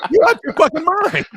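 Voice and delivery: high, laughing through the words; laughing disbelief. Real, not acted. Laughing at a woman who moved to Russia, in disbelief.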